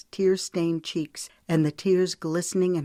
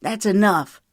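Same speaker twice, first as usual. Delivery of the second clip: sharp and louder, cutting her off; sharp retort; 4 semitones higher, 7 dB louder than usual. Acted. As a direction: shut her down, firmly. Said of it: "he countered sharply".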